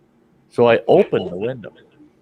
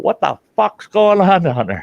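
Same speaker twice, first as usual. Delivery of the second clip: high, raised shout; retold yell; 10 semitones higher, 5 dB louder than usual. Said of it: "so I yelled out".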